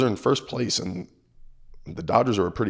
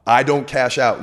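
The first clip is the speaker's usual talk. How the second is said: "I don't cash out" proclaimed louder and higher; excited declaration; 5 semitones higher, 7 dB louder than usual. Acted. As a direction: hype it up like a slogan. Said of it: "Need it on a t-shirt".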